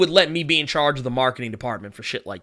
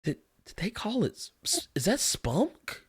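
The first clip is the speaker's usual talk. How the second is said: very quiet, halting mock confusion; hushed bewilderment; 2 semitones higher, 15 dB quieter than usual. Host acting Tobey's look at the title, hushed and bewildered. Acts out Tobey's stunned face.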